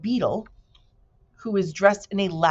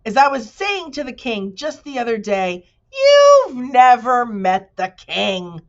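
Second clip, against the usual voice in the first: higher, louder, slowing in anger; angry accusation; 7 semitones higher, 8 dB louder than usual. A narrator's reading. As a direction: jab the accusation at him. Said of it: "accused the bee angrily".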